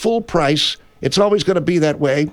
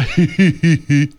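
imitated snicker; 3 semitones lower than usual. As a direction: snicker like you don't believe it. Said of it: "look at you and go".

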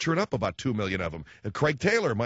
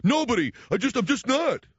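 put-on high, loud child's protest; imitating a cornered child; defensive kid; 10 semitones higher, 4 dB louder than usual. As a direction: deny it sulkily in a kid's pitch. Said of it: "with one of the kids".

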